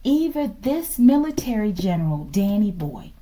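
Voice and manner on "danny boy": ordinary talk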